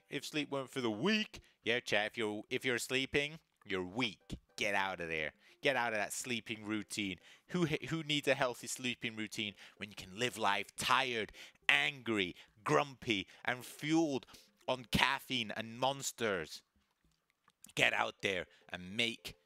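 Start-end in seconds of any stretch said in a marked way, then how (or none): none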